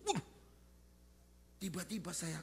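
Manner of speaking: usual speaking voice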